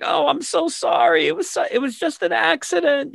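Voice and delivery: very high-pitched, put-on apology; mock pleading in a squeaky high register; apologetic sister. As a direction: imitate a girlish excuse way up high.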